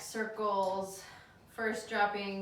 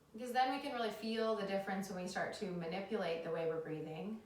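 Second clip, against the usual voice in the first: calm them down into the breath; soothing yoga teacher; 3 dB quieter than usual. Acted.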